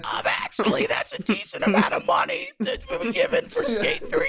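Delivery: put-on high rasping old smoker voice; Steve impression. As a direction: play an old smoker, laughing through it.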